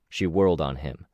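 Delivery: ordinary delivery, nothing marked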